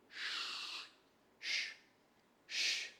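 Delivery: "Ch" as a long hushing sh, no vowel; sch sound. Shown as a demonstration. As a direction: demonstrate the "ch" as a pure shushing sound. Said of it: "S C H". (« ch » soufflé comme chut, sans voyelle)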